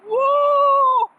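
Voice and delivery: high, loud whoop of alarm; a sudden shrieking woo; overwhelmed woo. Retold or imitated. Learner overwhelmed by fast Spanish, shrieking woo. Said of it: "and I'm like".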